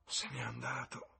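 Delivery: soft, wistful murmur; sad murmur. A narrator's reading. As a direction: let it fall away softly and sadly. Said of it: "mormorò Sibyl con aria triste".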